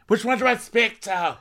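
high, excited imitation juggling calls; hammed-up scattered phone drama; mock frantic Lisa. Host imitating Lisa juggling calls, high and excited.

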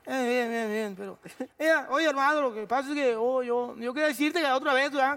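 high rough imitation of the boxer Julio; Julio Chávez impression. Telling the actor how he talks, imitating the boxer. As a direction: imitate Julio's rambling slurred talk. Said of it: "yo no hablo así".

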